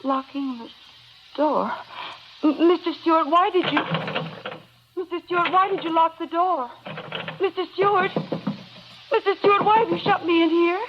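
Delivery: frightened pleading. Acted. Trapped housekeeper begging Mister Stewart to unlock the door, panicking. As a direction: beg to be let out, growing desperate.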